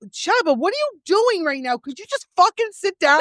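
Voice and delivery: high-pitched, exasperated scolding in fun; mock exasperation. Host to fidgeting co-host mid-confession, mock exasperation. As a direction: scold a friend who won't keep still.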